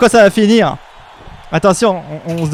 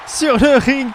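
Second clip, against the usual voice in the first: slow, higher ring-announcer shout; hyped announcer call; 6 semitones higher, slower than usual. Acted. Commentator to viewers: drawn-out announcer shout. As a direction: call it out like a boxing MC.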